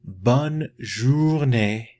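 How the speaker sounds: "bonne journée" at half speed, each syllable clear; teacher modelling the phrase slowly for students; slow demo take. Shown as a demonstration. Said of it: "if you like to say".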